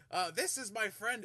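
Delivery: high, bright host voice; Volo introducing. Acted. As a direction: present the big man proudly.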